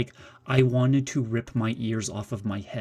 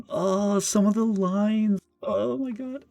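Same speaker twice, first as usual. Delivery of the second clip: much higher, slow disbelieving squeal ending in a laugh; aghast squeal; 11 semitones higher, 3 dB louder, slower than usual. Real, not acted. Gamer squealing to viewers over awful dialogue, aghast.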